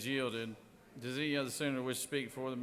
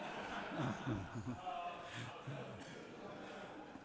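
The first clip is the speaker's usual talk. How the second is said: laughing; 5 dB quieter than usual. Real, not acted. President laughs at the joke.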